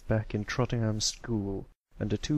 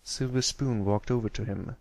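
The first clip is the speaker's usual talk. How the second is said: plain reading voice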